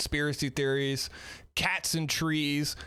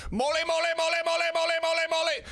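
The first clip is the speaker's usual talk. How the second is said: higher and louder, hammed-up blurting; mock blurting; 13 semitones higher, 4 dB louder, much faster than usual. Quoting the Austin Powers scene, hammed-up blurting. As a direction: blurt it out compulsively. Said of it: "the whole scene in Austin Powers".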